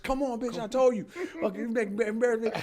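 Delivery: high, strained mock fury; high-pitched hammed-up scolding, not shouted; acted angry man. Acts man dragging woman out.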